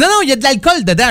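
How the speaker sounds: put-on high, fast children's protest; kids protesting. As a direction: rush it out like worried kids. Voicing his children's protest.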